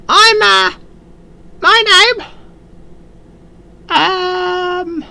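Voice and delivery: very high, slow squeaky goblin. Acted. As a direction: draw it out in a tiny goblin squeak. Goblin tries to introduce himself.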